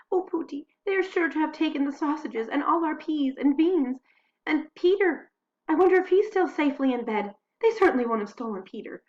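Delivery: high, loud, panicky chatter; scared wife waking her husband in the night; frightened wife. Acted. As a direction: fret out loud about burglars, pitched high. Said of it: "frightened fatalist".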